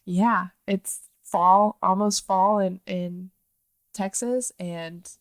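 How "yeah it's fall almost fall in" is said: ordinary delivery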